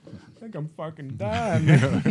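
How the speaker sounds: slow, dragged-out groan swelling into a loud cry; dying Stu. Retold or imitated. Stu complaining to Billy that he's dying, slow loud groan. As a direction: moan it like you're bleeding out. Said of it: "He's like".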